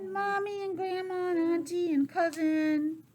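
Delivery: put-on high, slow sing-song kid-show voice; singsong teacher. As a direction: chirp it brightly like a preschool host.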